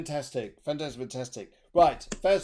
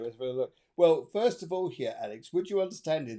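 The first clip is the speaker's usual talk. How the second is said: normal talking voice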